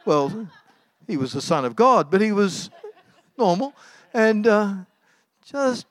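ordinary delivery

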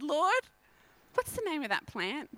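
ordinary talk

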